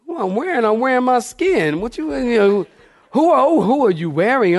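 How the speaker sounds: shrill mock disbelief; incredulous high retort. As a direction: throw the question back, baffled. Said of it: "I'm like".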